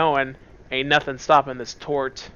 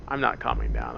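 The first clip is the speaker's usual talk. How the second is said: ordinary talk, nothing marked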